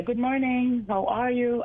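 English-sounding greeting, lifted pitch; English twang. Shown as a demonstration. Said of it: "listen to the cadence".

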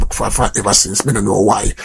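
usual speaking voice

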